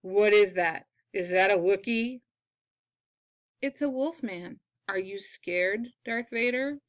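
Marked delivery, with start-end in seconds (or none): none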